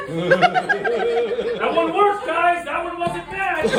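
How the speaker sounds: high, slow, laughing through the words; delighted the joke landed. Real, not acted.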